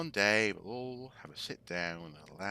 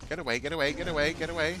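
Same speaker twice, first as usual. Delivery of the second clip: panicked; 7 semitones higher, 5 dB louder, much faster than usual. Real, not acted. Chased again by monster.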